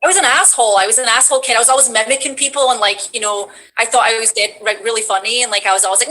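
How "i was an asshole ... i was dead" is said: normal talking voice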